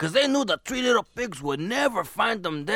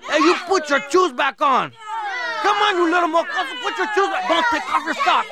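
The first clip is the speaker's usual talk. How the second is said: loud, high-pitched scolding shout; alarmed scolding; 7 semitones higher, 6 dB louder than usual. Acted. Storyteller to kids taking shoes off, panicked scolding. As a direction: yell at the kids to stop.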